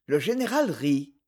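usual speaking voice

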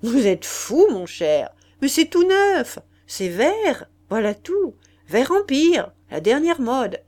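aristocrate indigné, très fort et plus aigu; marquis exalté. Acted. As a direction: protester bruyamment, bonimenteur emporté. Le marquis proteste avec exaltation. (marquis emporté, voix forte et haute)

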